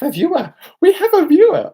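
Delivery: high, loud thrilled shriek; excited squeal. Real, not acted. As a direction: squeal with delight. First viewer arrives.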